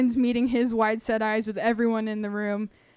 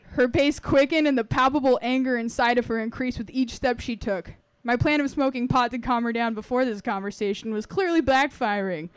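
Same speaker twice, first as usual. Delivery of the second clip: usual speaking voice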